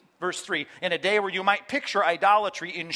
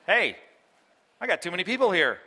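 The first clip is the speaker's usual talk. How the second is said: louder, raised voice, exasperated; mock exasperated Jesus; 4 semitones higher, 5 dB louder than usual. Jesus to the large crowd, mock exasperated and loud. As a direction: wave off the crowd impatiently. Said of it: "Jesus says".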